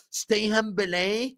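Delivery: mock Haaland. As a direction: mimic his smug line with a laugh. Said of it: "ever since Haaland said".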